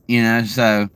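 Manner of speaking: ordinary talk, nothing marked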